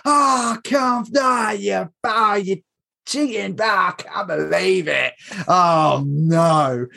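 high, strained moaning at the referee; frustrated groans. Retold or imitated. Fan in the Arsenal end moaning at Liverpool's goal, high frustrated groans. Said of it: "So I was sat there going".

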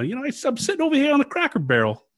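a shrieking mock Long Island grocer; New York accent. Host mocks the swindler in a New York accent, rising to a shout. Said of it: "He'd be like".

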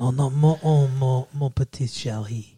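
playful mock-French accent. Boyfriend wooing fiancée in a mock French accent. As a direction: croon it like a cartoon Frenchman. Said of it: "my French accent".

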